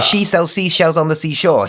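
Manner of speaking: "She sells seashells" said loud and crisp; tongue twister. Shown as a demonstration. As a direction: demo of "seashore" sounds.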